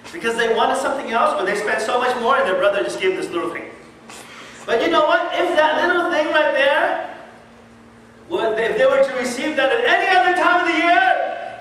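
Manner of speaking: loud, high preaching at full force; fiery preaching. Acted. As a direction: hammer it home to the congregation.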